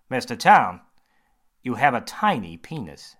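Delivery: a confident doctor holding forth; surgeon voice. Acted. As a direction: surgeon quizzing James, bright and loud.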